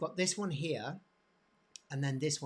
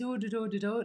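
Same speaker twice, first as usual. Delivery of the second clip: mock Brummie lilt, pitched up; Brummie accent; 7 semitones higher, 4 dB louder than usual. Shown as a demonstration. Teacher showing what Birmingham talk sounds like, higher mock Brummie accent. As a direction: caricature Brummie sing-song in nonsense syllables. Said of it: "a specific accent".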